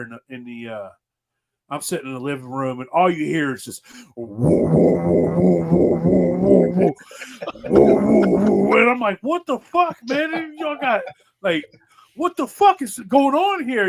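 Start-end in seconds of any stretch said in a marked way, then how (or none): 4.2-8.8 imitated vibrating moan. Friend retelling the noise from the next room, loud mock buzzing. 9.2-13.9 incredulous yell. Retold or imitated. Friend retelling his reaction to the noise, high mock shout.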